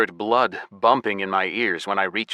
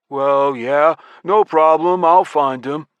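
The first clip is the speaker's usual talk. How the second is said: louder, higher put-on character voice; copying another's speech; 7 semitones higher, 6 dB louder, slower than usual. A narrator's reading. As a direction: brush it off in an imitated voice. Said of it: "I copied Banger's manner of speech and intonation".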